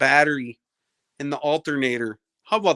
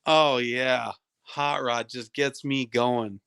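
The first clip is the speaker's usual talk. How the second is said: low, slow fake swooning; mock arousal; 6 semitones lower, slower than usual. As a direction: drag it out low, pretending to be turned on. Sarcastic pretend arousal at a heckler.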